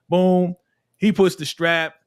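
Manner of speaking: ordinary talk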